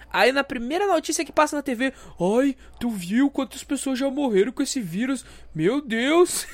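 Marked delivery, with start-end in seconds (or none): 2.2-6.5 higher, fretful voice, a touch of laughter; mock worried mom. Mother alarmed by TV news, mock fretful voice. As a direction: play the panicking mother.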